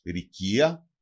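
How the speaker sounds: ρικία with i in the first syllable, stressed on KI; dialect pronunciation. Shown as a demonstration. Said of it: "Προφέρεται και ως".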